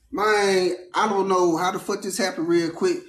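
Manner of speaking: ordinary delivery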